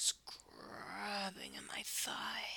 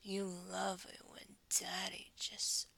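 ordinary delivery

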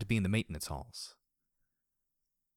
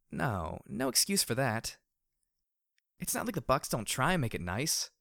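higher-pitched, irritated complaint; annoyed; 5 semitones higher than usual. A narrator's reading. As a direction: grumble it with mild annoyance. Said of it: "I said in annoyance".

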